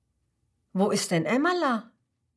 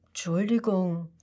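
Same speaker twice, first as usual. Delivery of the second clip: ordinary delivery, nothing marked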